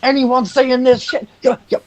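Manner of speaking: high, frantic yelling in a put-on voice; panicked screaming Hercule. The rogue screams at the party about the burning sky, high and panicked. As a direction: shriek it like the sky is falling. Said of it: "Hercule begins screaming".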